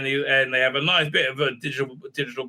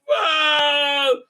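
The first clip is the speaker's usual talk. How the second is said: high startled whoa; a yelped cry of alarm; kid's pitched-up shout of surprise; mock yelp; 11 semitones higher, 4 dB louder than usual. Kid yelps as Pike fights.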